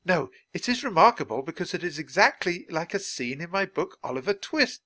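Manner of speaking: high, excited stress on IS; animated insistence. A narrator's reading. Charles tells Jack it matches his book, animated. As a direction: insist eagerly, voice lifted well up.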